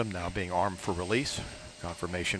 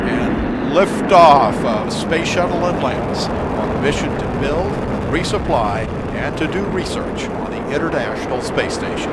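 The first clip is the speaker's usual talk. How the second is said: excited liftoff call; 5 semitones higher, 12 dB louder than usual. Real, not acted. Announcing the shuttle liftoff.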